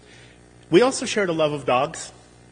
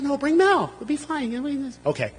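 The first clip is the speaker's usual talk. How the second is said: coaxing; 9 semitones higher, 3 dB quieter than usual. Retold or imitated. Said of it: "I said".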